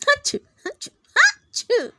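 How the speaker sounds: high, loud sneezing fits; repeated sneezes. A narrator's reading. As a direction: sneeze three times, loud. Cheerleaders can't stop sneezing.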